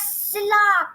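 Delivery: silla as SIL-la with an l sound; mistaken pronunciation. Shown as a demonstration. Teacher rejecting the English reading, silla said SIL-la. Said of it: "is not known as".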